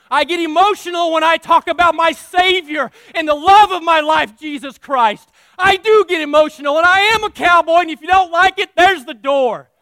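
loud, rising preacher's cry; passionate preaching. Acted. Preacher pleading with the church, loud and passionate. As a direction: pour the fire into it.

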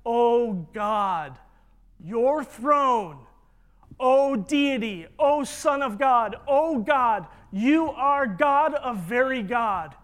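loud, ringing acclamations one after another; big, forceful declamation with weighty pauses; exalting proclamation. Acted. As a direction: hail him at full preaching force. Preacher voices Father exalting the Son.

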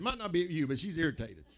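usual speaking voice

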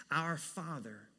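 ordinary delivery

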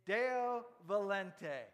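Deo volente, said loud and slow as DAY-oh vo-LEN-teh; Latin phrase. Shown as a demonstration. Preacher teaching the congregation to say Deo volente. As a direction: pronounce Deo volente clearly for the crowd. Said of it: "Say it".

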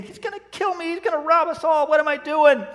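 high-pitched, spiralling mock panic; mock panicked thought. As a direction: imitate frightened thoughts in a high voice. Woman's panicked thought in retold story.

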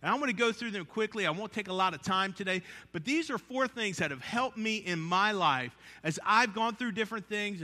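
usual speaking voice

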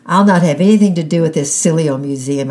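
loud, hurt outburst; angry shout. A narrator's reading. Lonely girl lashing out at the other children, angry shout. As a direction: yell it and storm off. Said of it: "I shouted".

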